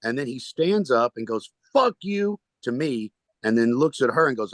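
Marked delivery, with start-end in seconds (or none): 1.7-2.4 high mock yell of a heckler; retold yelling. Heckler stands up and turns on the comedian, retold yelling. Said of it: "he started yelling".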